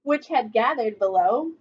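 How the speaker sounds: ordinary delivery, nothing marked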